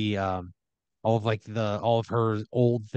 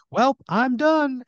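loud, high, drawn-out cartoonish voice; high-pitched sing-out, stretched long; Kevin Heaven; 8 dB louder, much slower than usual. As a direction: announce it high and slow, really loud. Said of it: "So Kevin Heaven is like".

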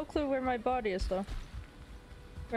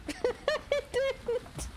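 high giggly denial; cartoon laugh; 13 semitones higher than usual. Real, not acted. Forgot to name the portal.

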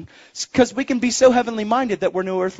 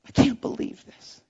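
whispery, mock-tearful voice; imitated crying; 7 dB quieter than usual. Bride's mother thanked for coming to the wedding, tearful. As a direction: softly imitate someone in tears. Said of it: "And she's crying".

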